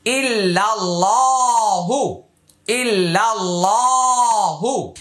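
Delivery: slow, drawn-out Quranic recitation. Shown as a demonstration. Teacher modelling the phrase for learners to memorise, slow chant. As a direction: draw each vowel out like a reciter.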